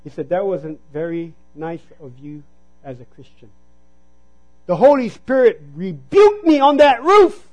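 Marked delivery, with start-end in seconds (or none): 0.3-3.5 gentle rebuke. Retold or imitated. Voicing the Lord's rebuke.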